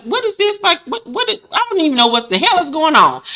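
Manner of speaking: high, baffled what-is-this; confused disbelief. Retold or imitated. Rita baffled by the lawyer's questions, high confused voice. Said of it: "And Rita looked like".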